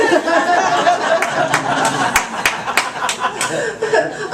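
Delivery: loud, high, helpless laughter at her own punchline; long real laugh. Real, not acted. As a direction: let the laugh roll on.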